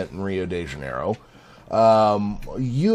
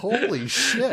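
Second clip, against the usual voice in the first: high, laughing exclamation; cracking up in disbelief; 12 semitones higher, slower than usual. Retold or imitated. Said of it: "I was like".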